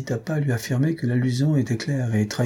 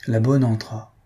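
ordinary delivery, nothing marked

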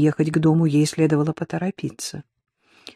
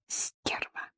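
whispered, very soft curse; private thought; 16 dB quieter than usual. A narrator's reading. Man thinks it as she hangs up, whispered. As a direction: mutter it under your breath as a thought. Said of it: "привычно подумал он".

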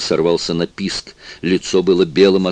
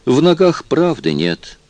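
suave, higher, gracious remark; polite society tone; 8 semitones higher than usual. A narrator's reading. Obispo settling on the couch, polite society tone. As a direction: play the genial host. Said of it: "любезным светским тоном заметил он".

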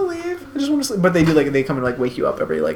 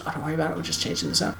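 hushed nurse voice; 8 dB quieter, much faster than usual. Retold or imitated.

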